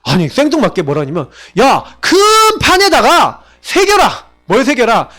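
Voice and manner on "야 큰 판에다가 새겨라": voicing God, slow and emphatic; commanding divine voice, slowed down; God's command. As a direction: speak as God giving an order, drawn out. Preacher voices God commanding Habakkuk.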